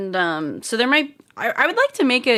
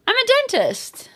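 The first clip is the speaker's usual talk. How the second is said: high eerie dentist voice, higher pitch; creepy dentist voice; 5 dB louder than usual. Retold or imitated. Streamer mimicking a creepy dentist, high eerie voice. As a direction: play the menacing dentist from the game. Quoting horror dentist clip.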